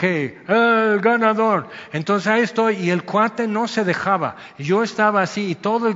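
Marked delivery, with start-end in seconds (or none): 0.0-1.7 slow, loud drawn-out call of the winner; mock referee announcement. Referee declaring the wrestling match winner, loud and slow.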